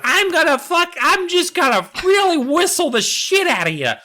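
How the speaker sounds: put-on high, smug little girl voice. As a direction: play the kid who has the whistle. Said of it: "she's like".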